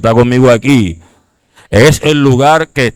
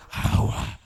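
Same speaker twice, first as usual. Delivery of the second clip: whispered, very soft plea for water; possessed whisper; 16 dB quieter than usual. Acted. Acts possessed person asking for water. (susurro de poseído)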